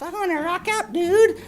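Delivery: chirpy little dragon saying hi; silly puppet creature. Acted. Grork wants to rock.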